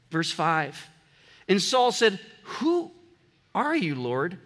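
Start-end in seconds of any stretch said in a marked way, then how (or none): 2.4-4.4 trying to figure out. Acted. Saul on the ground asks the unknown voice, puzzled. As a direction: break after the opening word as if working it out. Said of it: "He's trying to figure this out".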